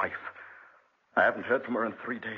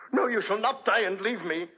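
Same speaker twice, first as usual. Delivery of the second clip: desperate plea; 9 semitones higher than usual. Acted. Husband refusing wife's dying.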